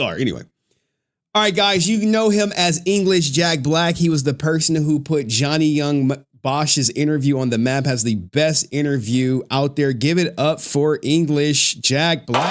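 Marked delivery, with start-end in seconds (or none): 1.3-12.5 raised, projected show-host intro; announcer introduction. Real, not acted. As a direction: build up to the guest's name, stretching it out at the end. Introduces guest to the stream.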